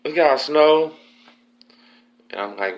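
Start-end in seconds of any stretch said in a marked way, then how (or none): 0.0-0.9 high, loud call-out through a vent; imitated yelling. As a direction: imitate an inmate hollering for someone. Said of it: "they're yelling back and forth in the vent".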